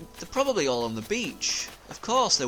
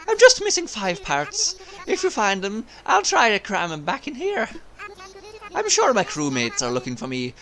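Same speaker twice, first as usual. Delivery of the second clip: lively sailor voice, jumping up high; animated castaway seagull; 10 semitones higher, 5 dB louder than usual. Acted. Castaway hands over the search, animated and often high-pitched. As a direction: play an eager cartoon sailor bird. Voices Gulliver explaining the task.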